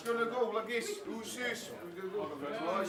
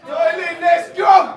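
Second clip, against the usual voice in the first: punchy hype call, louder at the end; round-starting shout; 9 dB louder, much slower than usual. Real, not acted.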